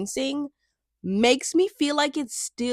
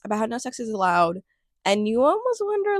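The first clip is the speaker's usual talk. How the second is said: ordinary talk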